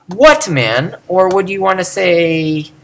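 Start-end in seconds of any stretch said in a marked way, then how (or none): 0.1-1.0 what raised high, man dropping away; stress on what. Shown as a demonstration. Teacher questioning the emphasis on what, stressing it. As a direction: lean heavily on what. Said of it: "Would you wanna emphasize".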